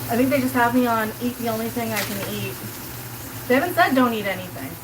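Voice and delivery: ordinary delivery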